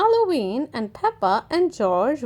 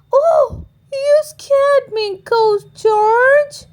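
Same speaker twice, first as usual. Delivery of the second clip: high, louder playful fright; mock startled granny; 12 semitones higher, 6 dB louder, slower than usual. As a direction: pretend to be scared.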